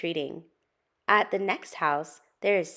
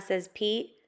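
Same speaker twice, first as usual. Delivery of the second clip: usual speaking voice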